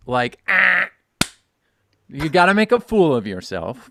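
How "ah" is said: loud, high nasal buzzer blurt; obnoxious buzzer noise. Shown as a demonstration. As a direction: blurt a fake buzzer sound. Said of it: "You have to make an obnoxious buzzer noise".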